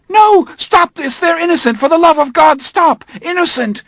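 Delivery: very high, loud anguished screams; desperate screaming. Acted. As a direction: beg them to stop the killing. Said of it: "He was screaming".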